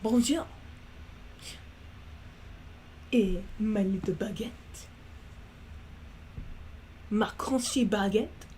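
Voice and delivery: slow put-on French accent, savoring the bread; playful fake French lilt, lightly done; mock French accent. Practicing her French girl character, mock French accent. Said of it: "It's like".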